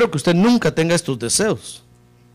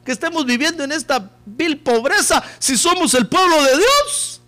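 indignant king; 8 semitones higher than usual. Retold or imitated. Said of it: "dijo el rey".

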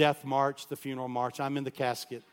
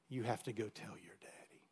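soft whisper, holding back tears; tearful whisper; 3 semitones lower, 10 dB quieter than usual. Retold or imitated. Mother in tears telling her son to confess, whispered. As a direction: barely breathe it out.

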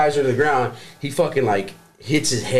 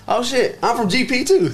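high, eager voice, a laugh in it; mock excited buddy; 12 semitones higher than usual. Buddy cheering he's from GP too, high and eager. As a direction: play the excited buddy higher. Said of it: "he goes".

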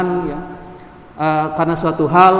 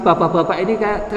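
ordinary talk, nothing marked

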